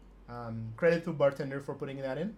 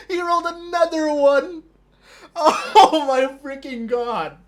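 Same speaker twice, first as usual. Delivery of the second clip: much higher and louder, crying with laughter; laughing disbelief; 9 semitones higher, 10 dB louder than usual. Real, not acted. Streamer telling Scott the enemy rolled again, shrieking with laughter. Opponent rolls another six.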